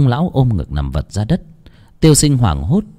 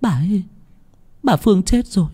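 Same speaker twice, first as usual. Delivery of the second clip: high, soft, faltering voice; panicked daughter; 10 semitones higher, 3 dB quieter than usual. Acted. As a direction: break dreadful news haltingly. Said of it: "Nguyệt hốt hoảng". (giọng con gái hoảng sợ, ngập ngừng)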